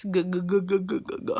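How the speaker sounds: repeated "g" clicked from the glottis, quiet; throaty "g" drills; glottal G sounds. Shown as a demonstration. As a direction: tap out "g, g, g" in the throat. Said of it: "the sound that G makes".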